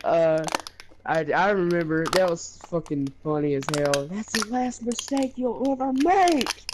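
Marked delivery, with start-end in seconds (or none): 4.1-6.6 a hammed-up villain's vow, pitched higher; mock villain threat. Friend retelling his game threat, in a hammed-up villain voice. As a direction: deliver it like a melodramatic movie villain.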